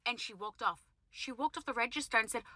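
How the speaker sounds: normal talking voice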